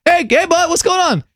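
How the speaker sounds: high-pitched hollered greeting, imitated; hammed-up bro calling across the street; brother-in-law impression. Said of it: "My brother-in-law, who I lovingly call Super Soldier".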